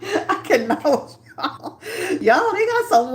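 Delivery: cracking up at the chat; can't believe it, laughing; laughing disbelief. Real, not acted. Chat voting, she laughs.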